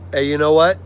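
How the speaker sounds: ordinary delivery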